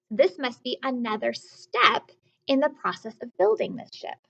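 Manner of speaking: normal talking voice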